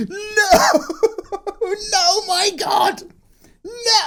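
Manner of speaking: shrieking no with laughter; panicked squealing at the screen; high-pitched shout. Retold or imitated. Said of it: "I'm shouting and getting all high-pitched".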